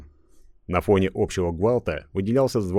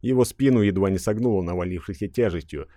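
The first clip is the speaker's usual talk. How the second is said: plain reading voice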